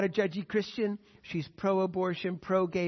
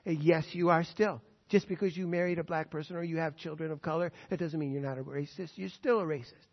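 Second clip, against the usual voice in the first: ordinary delivery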